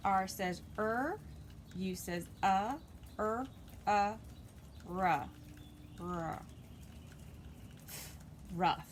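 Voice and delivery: er, uh, ruh blended slowly into Rough; sounding out. Shown as a demonstration. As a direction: stretch each sound, then blend. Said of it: "teacher sounding out phonics".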